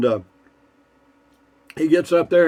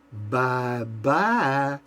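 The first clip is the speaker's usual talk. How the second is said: mocking bye-bye; 5 dB quieter than usual. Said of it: "kind of an attack on his manness".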